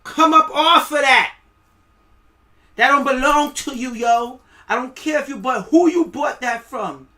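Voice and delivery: loud, high, aggressive enforcer shouting; mock official demand. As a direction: bark it like a thug seizing your food. Acted official seizing your vegetables.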